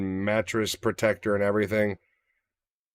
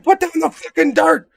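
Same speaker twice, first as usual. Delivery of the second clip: shrieking yell, far higher and louder; yelling at dog; 8 dB louder than usual. Retold or imitated. Owner in the backyard shrieking at the dog. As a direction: scream it across the backyard.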